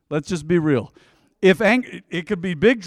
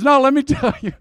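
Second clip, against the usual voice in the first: higher, laughing insistence; laughing brother; 9 semitones higher, 6 dB louder, faster than usual. Retold or imitated. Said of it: "He goes".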